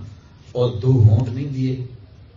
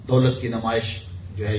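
ordinary delivery

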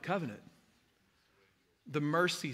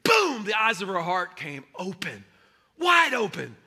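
loud, high proclamation; bursting with joy; triumphant shout; 5 semitones higher, 10 dB louder than usual. Acted. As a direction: shout the revelation. Climax of the story.

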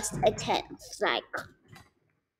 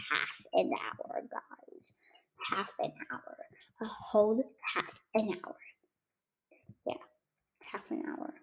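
whispering; 10 dB quieter than usual. Real, not acted. Stressing how long it would take.

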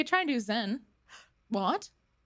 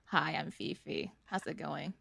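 much lower, soft character voice; Fifi's voice; 12 semitones lower, 8 dB quieter than usual. Acted. Imitating Fifi for the voice actress, low and soft. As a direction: drop low and quiet for Fifi. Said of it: "And then Fifi's voice".

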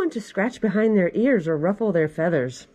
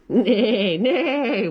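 whinny; 3 semitones higher than usual. Acted. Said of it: "whinnied the horse".

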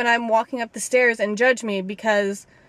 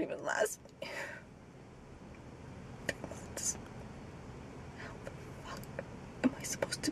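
whisper; 21 dB quieter, faster than usual. Pretend. Despairing aside about jeans.

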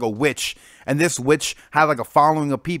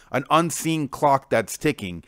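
ordinary talk, nothing marked

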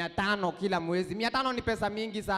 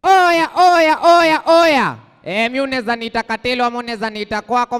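high, shouted hype greeting to the crowd; loud stage entrance; 3 semitones higher, 13 dB louder than usual. Acted. Katelo introducing himself to the audience, loud hype shouting. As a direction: burst on loud and keep calling out.

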